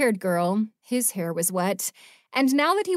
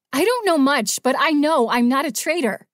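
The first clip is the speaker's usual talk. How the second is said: higher, much louder outburst; defiant shout; 6 semitones higher, 7 dB louder than usual. Acted. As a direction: yell back in defiance. She shoves him, defiant.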